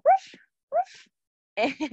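high yappy ruff ruff like a little puppy; playful puppy barks. Pretend. Teacher barking for kids in downward dog, high yappy. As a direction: yip like a tiny dog. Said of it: "and bark".

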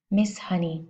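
plain reading voice

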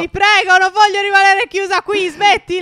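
high, loud play pleading; desperate begging. Pretend. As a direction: beg loudly not to be shut in. Begs not to be left locked in. (supplica disperata, gridata per gioco)

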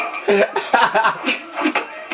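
high breathless laughter; laughing. Real, not acted. Player laughing at the Elephant Man fight. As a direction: crack up at the game.

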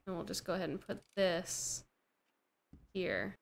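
ordinary talk

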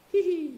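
squeaky high tee-hee; sly high-pitched pirate tee-hee; high giggle. Shown as a demonstration. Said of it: "That's how it's written".

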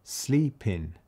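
"City sleeping" drawn out slowly; slow lyric reading. Shown as a demonstration. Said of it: "So I'm gonna say".

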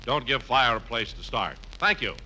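ordinary talk

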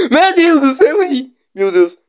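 high panicked cry; terrified cry. Real, not acted. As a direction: shriek it in fright. Monster scare.

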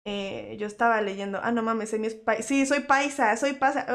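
normal talking voice

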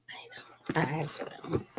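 soft whisper, confiding; whispered aside. Real, not acted. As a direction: lean in and whisper it.

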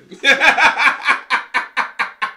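full loud laugh. Real, not acted. Laughing loudly at the workout video. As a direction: laugh out hard.

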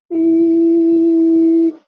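long, very high wail like an alarm; machine going off. Retold or imitated. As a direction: stretch it out high and slow like a warning machine. The machine starts beeping badly. (alarma de máquina, aguda y lenta)

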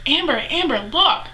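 mock urgent nudge to look; imitated urgent friend. Friend urgently gets her attention.

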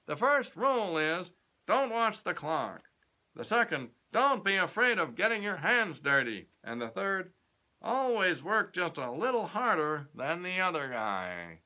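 a self-made boss lecturing a youth; grand old tycoon addressing a young man; pompous company president. Acted. President Kennedy voiced in the story.

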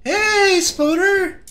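high excited call. Real, not acted. Greeting the spider creature.

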